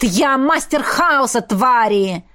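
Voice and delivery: restrained but defiant shout. A narrator's reading. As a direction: proclaim it as a challenge. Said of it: "выкрикнул Эммет". (дерзкий выкрик-вызов)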